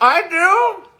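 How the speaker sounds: high, loud, incredulous question; loud surprise. Acted. Told about an upcoming show, loud surprised question. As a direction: shoot way up high in disbelief.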